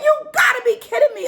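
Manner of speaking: high, fast cry of disbelief; incredulous outburst. Retold or imitated. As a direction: retell it as an exasperated shout. Retold argument on phone.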